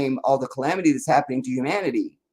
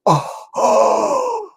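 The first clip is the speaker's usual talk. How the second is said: squealed very high, louder gasps of shock; mock shock; 5 dB louder than usual. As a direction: act scandalized, clutching pearls. Mocking shocked reaction to scandal.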